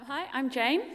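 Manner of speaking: normal talking voice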